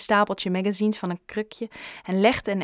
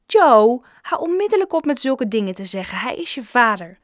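loud sharp rebuke, louder and higher; scolding; 4 semitones higher, 7 dB louder than usual. A narrator's reading. Shopkeeper scolds boy for hating his father, loud rebuke. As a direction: snap him out of it firmly.

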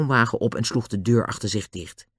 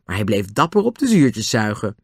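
ordinary delivery, nothing marked

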